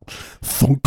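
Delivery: high, punchy sound effect; mock impact noise; imitated bolt thunk. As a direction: voice a bolt slamming through. Imitates bolt going through.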